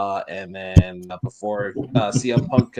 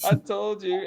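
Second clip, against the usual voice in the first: lightly imitated high gloating; Seth Rollins; 10 semitones higher than usual. Wrestler laughing at his rivals, lightly imitated gloating. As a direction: repeat it as a teasing mock crow. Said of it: "Seth Rollins was laughing his ass off".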